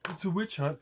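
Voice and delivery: high sarcastic mimic of a slogan; mocking quote. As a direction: parrot the catchphrase with contempt. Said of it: "the people on the right are saying".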